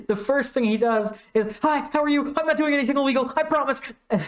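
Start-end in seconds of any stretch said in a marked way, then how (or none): 1.6-3.8 much higher, guilty and jittery; nervous high cat voice. Retold or imitated. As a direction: insist you're innocent, nervously.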